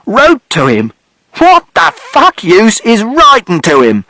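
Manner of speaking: contemptuous fury; father shouting. A narrator's reading. Father shouting scorn at mother's letters. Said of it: "And father shouted".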